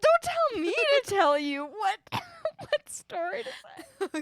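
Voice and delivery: loud, high-pitched protest breaking into laughter; mock protest. Real, not acted. Player told to pick a story, mock protest.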